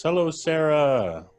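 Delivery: ordinary talk, nothing marked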